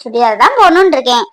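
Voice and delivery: ordinary talk, nothing marked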